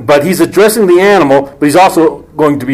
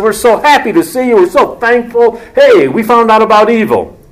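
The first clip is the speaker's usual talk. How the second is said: higher, overly bright gushing; a hammed-up happy greeting; mock cheerful; 7 semitones higher than usual. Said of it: "Adam and his wife ... said".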